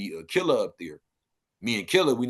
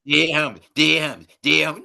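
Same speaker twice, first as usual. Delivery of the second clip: louder, higher repeated catchphrase; mocking parroting; 4 semitones higher, 5 dB louder than usual. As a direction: parrot the slogan in mockery.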